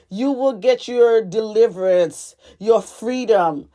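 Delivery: ordinary talk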